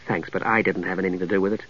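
low, soft bitterness; quiet, self-deprecating bitterness; bitter. Acted. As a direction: brush off the praise bitterly. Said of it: "with a return of his bitterness".

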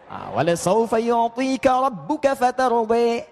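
slow chant with final vowels turned E, "saja" as "saje"; slow Warsh-style imalah chant; Quran recitation with imalah. Shown as a demonstration. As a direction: recite slowly leaning A sounds toward E. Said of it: "mereka Wadduha".

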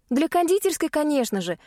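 high, eager burst; fervent. Acted. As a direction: say it with fervour. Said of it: "с запалом отозвалась я".

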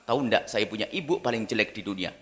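low, soft sneering gossip; sly low gossip. Pretend. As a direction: spread the slander quietly. Child slandering mother. (anak bergosip pelan menjelekkan ibu)

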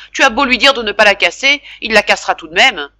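loud, high and certain; grumpy insistence. Acted. Father snapping to mother about Jacques, loud gruff certainty. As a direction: hammer home that he will break it.

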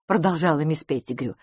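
ordinary delivery

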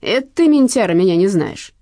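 loud retort, starting high; venomous thief, voiced aloud; hateful snarl. A narrator's reading. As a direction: throw it back with hatred. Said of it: "шипел карманник, с ненавистью".

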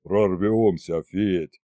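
low, slow, sighed reassurance; sighing. A narrator's reading. Sensible dragon head reassuring Fedya, sighing. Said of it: "вздохнула самая рассудительная правая".

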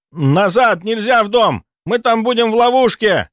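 loud high warning shout. Acted. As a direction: yell a desperate warning. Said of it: "закричал кто-то".

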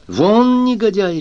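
high, loud bark of dismissal; barked order. A narrator's reading. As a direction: bark it out, pitch leaping up. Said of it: "рявкнул Фернан".

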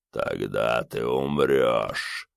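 deep, slow otherworldly rumble; dark lord. A narrator's reading. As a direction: rumble it like a voice from the grave. Skull monster speaks.

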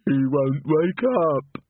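put-on high, slow, fretful young man's voice; a grieving simple lad, slow and high; tearful simple young man. Walter mourning the chorus master, high and slow.

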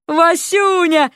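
high, drawn-out joyful holler. A narrator's reading. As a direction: yell the pet name, stretching it out. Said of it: "заорал, не слушая её".

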